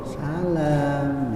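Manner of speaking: lightly voiced soft female phone greeting; woman on the phone. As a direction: imitate a woman answering softly. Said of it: "Perempuan kalau ditelepon".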